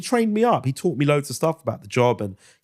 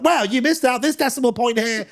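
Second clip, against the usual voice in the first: high put-on voice, correcting a colleague; imitating Justin; 13 semitones higher than usual. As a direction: squeak it out like Justin. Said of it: "He's like".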